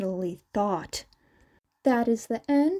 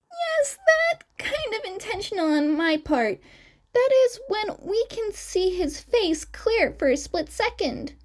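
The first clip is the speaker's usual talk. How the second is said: clearly higher, youthful twin character voice; 10 semitones higher than usual. Acted. Twin explaining to the interviewers, raised higher voice. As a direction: chirp the lines up high like the young twin.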